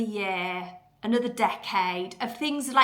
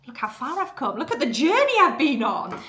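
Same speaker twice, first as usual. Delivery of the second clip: amazed pride; 4 semitones higher, 4 dB louder than usual. Acted. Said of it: "get to an end and go".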